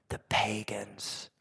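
hushed, breathy whisper of the last words. Retold or imitated. Jesus whispering the punchline to the crowd, hushed. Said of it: "And he goes".

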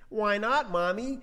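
put-on high, questioning kid voice; little child. Little one asking mommy why, high child voice. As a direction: ask mommy like a puzzled child. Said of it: "says the little one".

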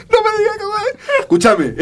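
very high voice breaking with laughter; helplessly amused, high squeaky words; laughing. Real, not acted. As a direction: struggle to talk through a laughing fit. Cracking up over a name.

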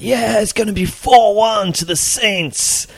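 high, slow, bad American accent; terrible American accent. Mimicking excited American fans on the train, bad American accent. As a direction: ham up an excited American fan. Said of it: "that's my terrible American accent".

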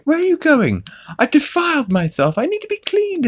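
very high put-on cartoon voice; Stewie. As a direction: imitate an indignant little cartoon villain in falsetto. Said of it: "And he's like".

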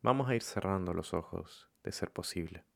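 calm, softened meditation voice with long pauses; guided meditation. Acted. Leading listeners to follow their breathing, calm meditation voice. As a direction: guide them gently, leaving room between phrases.

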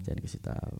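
secretive whisper. Real, not acted. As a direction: hush it like a secret. Keeping the plan a secret.

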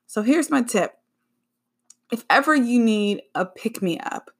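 ordinary delivery

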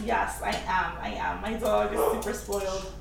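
ordinary talk, nothing marked

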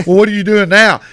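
mock a wife demanding an answer; unamused wife. Wife questioning her husband, mock loud demand.

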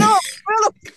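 high mock astonishment; mock surprise. Narrator reacting to the insult, high mock shock. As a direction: squeal it in fake shock.

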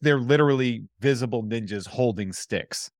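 ordinary talk, nothing marked

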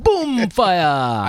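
put-on laughing Jamaican accent, boom up high. Repeating the joke laughing in a Jamaican accent. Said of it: "if it's Jamaican".

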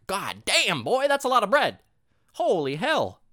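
high, loud mock astonishment; hammed-up disbelief at the bread; mock amazed exclaim. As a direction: gape at all that bread, hammed up. Mock reaction to food pyramid.

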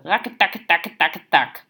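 loud, rhythmic mock gunfire rattle; machine-gun imitation. A narrator's reading. Man brandishes credit cards as guns, imitating machine-gun fire. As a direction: hammer out each beat like gunfire.

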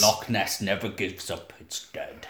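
low, hushed mock-ominous line; ominous pronouncement. Co-host on the corpses in the loch, mock ominous. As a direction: intone it softly like a grim legend.

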